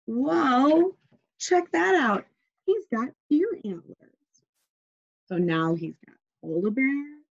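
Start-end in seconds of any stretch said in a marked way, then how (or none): 0.1-4.4 higher, delighted surprise; playful read-aloud amazement; amazed. Real, not acted. Reader amazed at picture.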